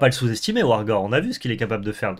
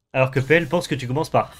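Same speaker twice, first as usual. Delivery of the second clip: ordinary talk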